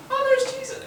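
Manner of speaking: very high squeaky little voice; squeaky Zacchaeus. Retold or imitated. Preacher mimics short Zacchaeus spotting Jesus, squeaky high. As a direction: squeak it like a tiny excited man. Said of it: "I don't know if you talk like that".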